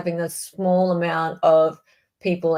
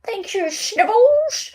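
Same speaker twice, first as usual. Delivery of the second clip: excited thanks; 13 semitones higher, 3 dB louder, slower than usual. Real, not acted. Thanking a donor on stream.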